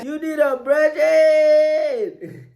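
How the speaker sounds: high, stretched holler; long high-pitched cry; drawn-out yell. Acted. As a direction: shout it high, holding the last word long. Yelling out during reaction.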